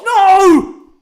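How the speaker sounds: high, very loud cry of dismay; anguished no. Real, not acted. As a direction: cry out as the goal goes in. Opponent scores, fan cries out.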